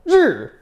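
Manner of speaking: 르 falling sharply from high; fourth tone. Shown as a demonstration. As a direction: drop 르 fast from the top. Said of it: "사성".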